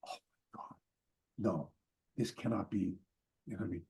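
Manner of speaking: whispered disbelief sinking into a low murmur; stunned whisper. Retold or imitated. Storyteller realising who is at the door, hushed disbelief. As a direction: breathe it to yourself, very low. Acted stunned aside to himself.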